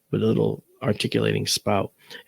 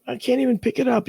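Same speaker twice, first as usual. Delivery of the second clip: retold helpless protest; 13 semitones higher, faster than usual. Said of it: "And I'm like".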